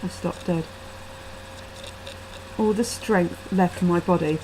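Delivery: ordinary delivery, nothing marked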